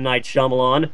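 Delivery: ordinary talk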